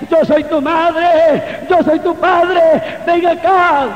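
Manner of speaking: loud, high acted plea to a child; padres llamando, imitados. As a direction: call the child back as the parents did, voice climbing. Acts out parents calling him back. (imitando el llamado a gritos de los padres)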